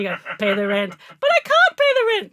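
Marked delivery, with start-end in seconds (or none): 0.4-0.9 menacing old cartoon villain demand; mustache twirling villain. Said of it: "you do it again". 1.2-2.3 very high, loud wailing damsel; damsel in distress. Pretend. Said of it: "you do it again".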